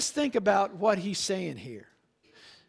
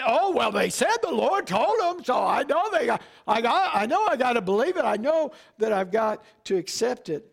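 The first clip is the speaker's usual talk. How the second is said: gullible believer; 3 semitones higher, 5 dB louder than usual. Retold or imitated. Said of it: "Don't get".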